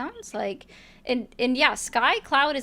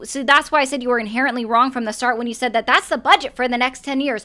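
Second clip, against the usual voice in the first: normal talking voice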